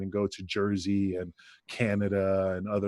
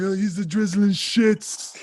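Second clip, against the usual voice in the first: mock Johnny jab; 8 semitones higher, 4 dB louder, slower than usual. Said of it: "the angel joking about Johnny".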